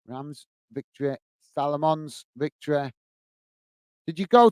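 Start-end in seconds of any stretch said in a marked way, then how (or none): 0.1-2.9 muttered softly under his breath, puzzling it out; soft, puzzled mutter, much softer; quiet mutter. Real, not acted. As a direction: mumble it to yourself while thinking. Quietly re-reading the comment to himself.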